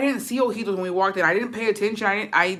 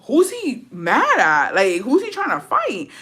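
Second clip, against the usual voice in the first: confused; 6 semitones higher, slower than usual. Retold or imitated. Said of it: "I was confused".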